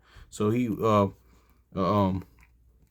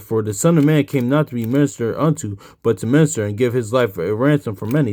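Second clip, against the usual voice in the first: scripture reading; 2 semitones higher, 6 dB louder than usual. Acted. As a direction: read the verse out with emphasis. Reads scripture aloud to teach.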